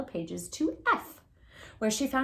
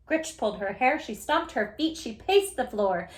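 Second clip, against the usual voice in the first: ordinary delivery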